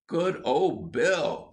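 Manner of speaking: admiring cheer. A narrator's reading. Erb praising Bill after the rescue, admiring cheer. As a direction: cheer him, drawing the words out.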